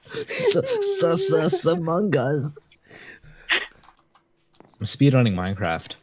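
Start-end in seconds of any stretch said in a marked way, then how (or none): 0.0-2.6 high breathless laughing stammer; can't get words out for laughing. Real, not acted. Laughing fit.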